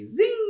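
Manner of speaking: a sudden high squeaky zing; zingy exclamation. Acted. Host throws in a playful high zing. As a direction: fling it out like a sparkle sound effect.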